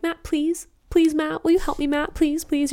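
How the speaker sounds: hammed-up desperate pleading to a friend; needy pleading. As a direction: play the clingy nag begging over and over. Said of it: "and I'm like".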